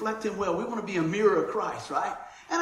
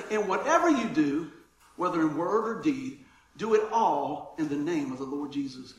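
scripture reading; 6 semitones lower than usual. Acted. Reads scripture aloud.

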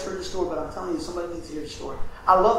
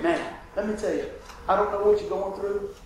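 ordinary talk

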